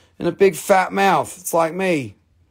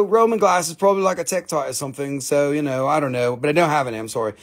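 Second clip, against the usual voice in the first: normal talking voice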